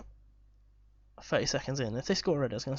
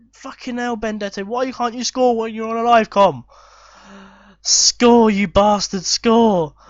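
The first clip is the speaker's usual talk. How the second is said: loud, high, frustrated yelling at the screen; furious shout; 6 semitones higher, 12 dB louder than usual. Real, not acted. Gamer yelling at his striker to score. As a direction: bellow in real exasperation.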